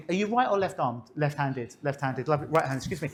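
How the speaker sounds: usual speaking voice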